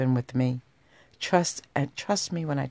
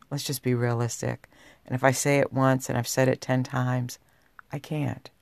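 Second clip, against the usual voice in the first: normal talking voice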